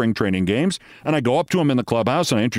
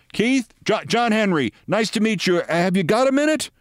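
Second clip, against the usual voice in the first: high-pitched, eager hail at usual volume; eager greeting; 9 semitones higher than usual. Retold or imitated. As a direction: hail someone you've been hoping to catch. Acting out owner's greeting across the dugout.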